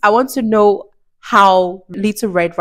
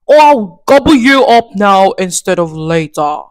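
louder, menacing wolf threat; threatening wolf; 6 dB louder than usual. Acted. The wolf threatening grandmother, loud menacing threat. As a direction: threaten her with a growl. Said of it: "he said".

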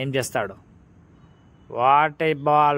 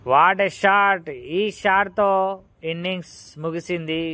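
excited cricket commentary; 4 semitones higher than usual. Real, not acted. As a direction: cheer the match-winning shot. Winning shot ends innings.

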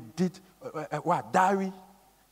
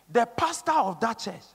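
ordinary talk

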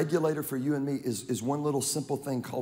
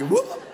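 high whooping call; Aggie whoop; 5 dB louder than usual. Retold or imitated. Preacher imitating Aggie fans, high whoop. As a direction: yelp a fan whoop. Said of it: "That's what you do all the time".

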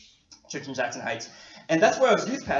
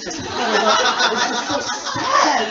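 laughing lament; 5 semitones higher, 7 dB louder, much slower than usual. Real, not acted. Mock-lamenting cold youth rooms.

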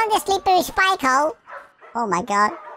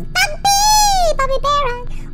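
playful squeal; 13 semitones higher, 3 dB louder, much slower than usual. Pretend. Silly guessing of creature names.

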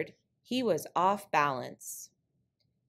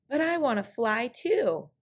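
higher, eager coyote voice; trickster tale voices; 6 semitones higher than usual. Acted. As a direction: push it up, wanting more. Coyote begging to fly.

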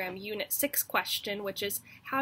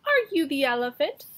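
cocky question; 4 semitones higher, 8 dB louder than usual. Acted. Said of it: "said the mouse".

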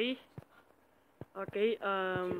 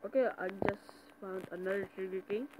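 ordinary talk

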